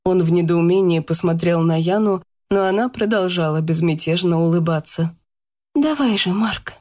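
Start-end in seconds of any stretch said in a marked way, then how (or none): 5.7-6.8 higher, gentle female voice; Yana's voice. A narrator's reading. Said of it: "сказала она".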